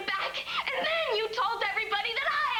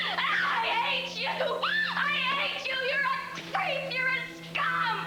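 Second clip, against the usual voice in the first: higher, rising fury at him; angry outburst; 4 dB louder, much slower than usual. Acted. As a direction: spit out the hatred.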